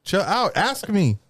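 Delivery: high, indignant complaint; indignant protest. Retold or imitated. Protesting to the barber forcing his head down, high and indignant. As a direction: push back, offended and high.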